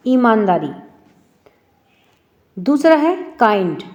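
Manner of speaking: ordinary delivery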